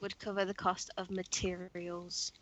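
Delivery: whispered aside; whispering. Real, not acted. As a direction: say it under your breath.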